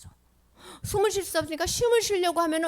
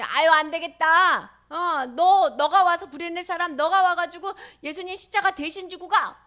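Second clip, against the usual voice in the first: loud, higher soldier's order; bossy soldier; 5 semitones higher, 8 dB louder than usual. Acted. Soldier ordering a bystander to carry the cross, loud and bossy. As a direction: order someone about loudly.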